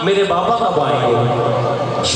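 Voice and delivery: put-on slow, loud plea of a little girl; drawn-out cry of a child for her father; child pleading. Little daughter tugging her mother's hem, slow loud plea. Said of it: "अम्मा का दामन हिला के कहती थी".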